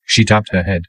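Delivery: usual speaking voice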